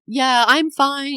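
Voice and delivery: high, loud, drawn-out reassurance, acted upset; mock distraught. Wife insisting to husband she is okay, mock distraught. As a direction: wail it slowly, as if barely holding together.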